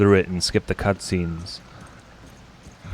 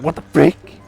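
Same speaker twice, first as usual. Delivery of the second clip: mock shock; 6 semitones higher, 7 dB louder than usual. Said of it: "I was like".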